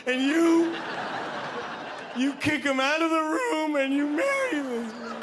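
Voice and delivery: high, slow, tearful mock yelling. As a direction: plead with the screen, overwrought and drawn out. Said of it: "yelling shit at the T V".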